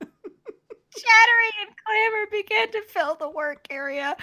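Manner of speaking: high voice squeezed by real laughter; laughing so hard it hurts; laughing to tears. Real, not acted. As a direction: read on through helpless laughter. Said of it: "I'm laughing so hard I'm crying".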